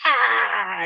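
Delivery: a high mock cry of a convulsing person; imitated convulsing cry. Mimicking people thrashing in ceremony videos, high mock cry. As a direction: throw out a sudden high strained Ah. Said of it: "in the videos, there's people like".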